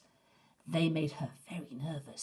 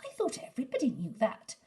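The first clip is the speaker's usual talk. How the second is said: put-on smug little boy; 5 semitones higher, faster than usual. A narrator's reading. Herb smug.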